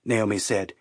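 ordinary delivery, nothing marked

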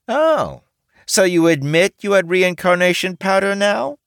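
high, loud triumphant gotcha; T smugly catching him out. A narrator's reading. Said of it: "T asked smugly".

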